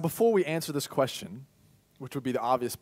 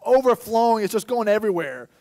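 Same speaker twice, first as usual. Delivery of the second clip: higher, louder, awed delight; joyful overflowing; 6 semitones higher, 5 dB louder than usual. Acted. God inviting his people to his fountain, joyful. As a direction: pour it out like a flood of joy. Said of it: "So God here's like".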